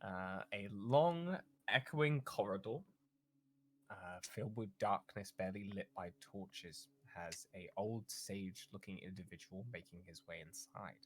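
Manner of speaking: soft, low ominous storytelling; hushed scene narration. Acted. Game master describing a dark corridor, hushed narration. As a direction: draw them into the dark corridor quietly.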